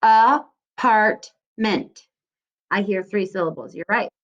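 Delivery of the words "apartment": Apartment stretched very slowly, syllable by syllable; slow syllable demo. Shown as a demonstration. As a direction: model Apartment slowly for children to echo. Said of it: "How many syllables do you hear".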